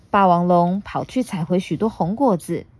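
ordinary delivery

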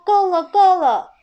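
高声大喊够了; 急着大喊制止; 大声喊停. Acted. 爷爷喊停. (高而大声地喊够了)

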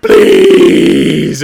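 long, loud mock wail; hammed-up bawling plea; crying. Fire-breathing dragon begging the guy, crying. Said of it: "red from crying".

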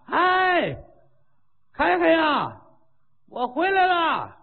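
high drawn-out call up to the wall. Acted. Monk below the drawbridge calling up to the guards, high hail. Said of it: "站底下喊".

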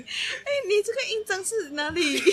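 hammed-up sleazy flirting, laughing; creepy flirt act. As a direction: play the greasy pickup guy, giggling through it. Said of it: "现场来模拟一下".

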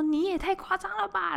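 high-pitched mock scolding of her brother; retold scolding. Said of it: "然后我就大骂". (高声假装骂弟弟)

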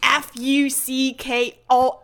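each letter spelled out very slowly. Pretend. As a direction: drag out every letter with amused disbelief. (一个字母一个字母慢慢拼出来)

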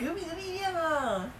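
drawn-out high heroic cry; hammed-up revolutionary slogan, stretched slow; mock rallying cry. A friend proclaiming the revolution, hammed-up and slow. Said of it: "这就是革命的力量啊".

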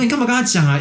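retold protest. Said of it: "我说".

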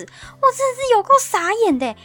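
a stunned, exasperated outburst; utterly dumbfounded. Real, not acted. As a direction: exclaim it like you can't believe it happened.

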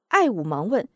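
ordinary delivery

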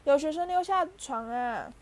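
high-pitched calling out; full-throated yell. A narrator's reading. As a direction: yell it out to raise the alarm. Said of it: "皮皮鬼扯开喉咙大叫".

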